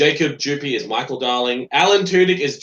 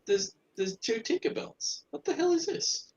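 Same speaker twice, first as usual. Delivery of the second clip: confused disbelief; 10 semitones higher than usual. Real, not acted. Confused by two Tinkerbells.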